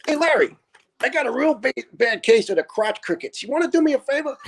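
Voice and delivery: high, put-on innocent voice; mock request to Larry. Gibbo teasing Larry in the bunk room, high mock innocence. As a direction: play the prankster asking a gross favor. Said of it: "all of a sudden he goes".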